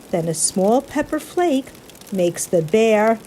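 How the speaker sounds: plain reading voice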